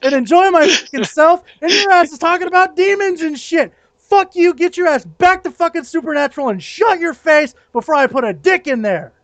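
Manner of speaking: high-pitched, worked-up tirade; venting rant. Real, not acted. Fed-up fairgoer telling off demon-slaying role players, heated venting. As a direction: let it all out at the fantasy crowd. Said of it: "I feel better".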